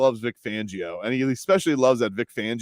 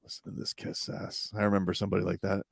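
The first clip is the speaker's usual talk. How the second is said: whispered, sneaky aside; whispered teammate gossip; 4 semitones lower, 8 dB quieter than usual. Retold or imitated. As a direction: whisper behind his back. Tua whispering to Skylar.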